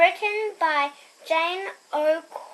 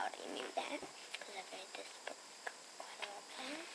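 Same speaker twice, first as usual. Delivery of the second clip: a soft unvoiced aside; whisper; 7 semitones lower, 23 dB quieter, faster than usual. Real, not acted. Reader confiding to viewers, hushed whisper. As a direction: whisper it as a secret. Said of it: "aside whispered to viewers".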